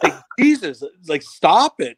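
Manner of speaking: retold angry plea to quit; pissed teammate. Teammate telling Blake off in the locker room, mock pissed. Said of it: "he's, he's pissed".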